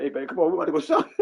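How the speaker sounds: fast, high, laughing hype; excited call. Retold or imitated. Calling Jim after getting the cash, fast laughing hype.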